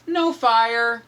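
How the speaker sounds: ordinary delivery, nothing marked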